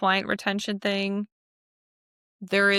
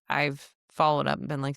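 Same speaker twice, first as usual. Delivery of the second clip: normal talking voice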